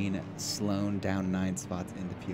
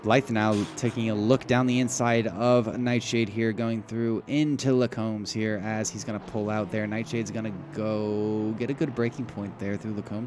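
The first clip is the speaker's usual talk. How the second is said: steady play-by-play; motorsport commentator; 4 dB louder than usual. Acted. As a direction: lively race call. Live race play-by-play.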